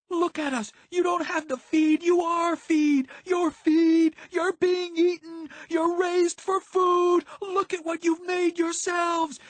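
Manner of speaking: very high, loud, drawn-out scream. A narrator's reading. Violet accuses her friends of being feed, screaming. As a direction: scream every phrase at full force. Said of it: "Violet was screaming".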